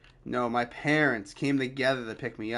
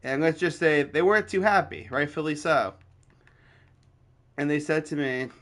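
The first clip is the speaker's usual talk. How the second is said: ordinary talk, nothing marked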